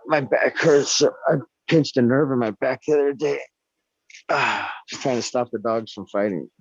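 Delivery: ordinary talk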